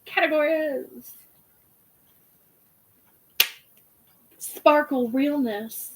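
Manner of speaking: slow, drawn-out ballroom call; drag ball announcer. Retold or imitated. Calling categories at a drag ball, slow and grand.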